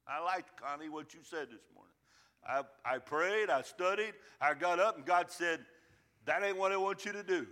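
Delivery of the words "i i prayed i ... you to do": loud, rising, then God's line quick; preaching build. Acted. Pastor recounting God's correction, loud and driving. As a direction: stack each step louder.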